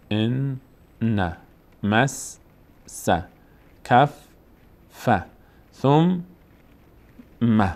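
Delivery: "ان", pause, "نه"; doubled letters, split. Shown as a demonstration. Likewise "کف"-"ف", "ثم"-"م". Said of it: "روی این دقت کرده باشن".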